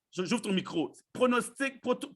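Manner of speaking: usual speaking voice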